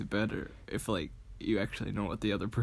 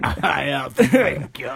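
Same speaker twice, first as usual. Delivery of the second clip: slow, louder cartoon duck echo; Daffy Duck; 4 semitones higher, 9 dB louder, much slower than usual. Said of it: "that's what I thought of".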